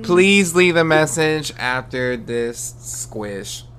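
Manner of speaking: slow answering machine voice; voicemail greeting. Pretend. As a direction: read it like a recorded greeting, slow.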